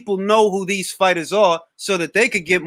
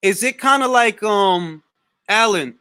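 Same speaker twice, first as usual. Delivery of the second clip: ordinary talk, nothing marked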